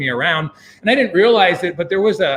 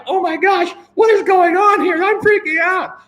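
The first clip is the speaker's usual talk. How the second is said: mock panicked shout, much higher and louder; 12 semitones higher, 4 dB louder than usual. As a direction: replay the terror as a hammed-up yell. Retelling panic at communist boat.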